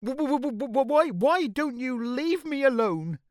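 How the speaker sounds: high, loud stuttering plea; nervous stammer. A narrator's reading. Nervous BFG begging to be left alone, stammering. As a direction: stutter into a squeaky, frightened plea. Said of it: "he stammered".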